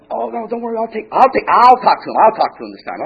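hurried-to-please owner, rising in volume; flustered owner placating. Acted. Owner placating an important customer.

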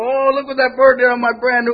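high, loud dismay; a fussy owner freaking out; upset car owner. Retold or imitated. Car owner upset at the bird mess, high and loud.

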